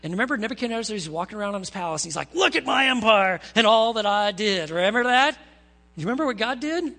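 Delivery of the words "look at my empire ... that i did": lightly hammed-up proud king, louder; a louder, mildly mocking royal boast; boastful king. King walking around his palace, showing off, boastful.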